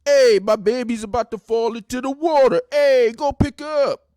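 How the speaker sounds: loud, high Jersey wise guy voice; shouted mock wise guy; wise guy impression. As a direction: ham up a Jersey Shore tough guy calling out. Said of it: "this is how they react with each other".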